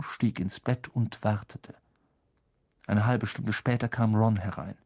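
plain reading voice

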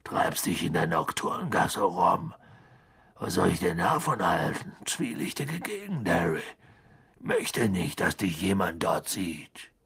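tiefe, veränderte Hagrid-Stimme; low giant's scolding rumble; Hagrid, brummig mahnend. A narrator's reading.

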